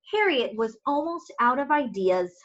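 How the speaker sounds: ordinary delivery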